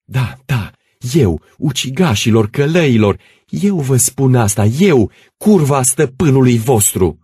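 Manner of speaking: loud, high, wild defiance; frenzied defiance. Acted. Stana facing the gendarmes, wild angry defiance. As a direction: throw it back in their faces. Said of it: "cu o privire de nebună gâfâind".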